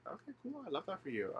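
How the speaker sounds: high, soft feigned approval; awkwardly polite. Acted. Awkward polite reply to oversharing.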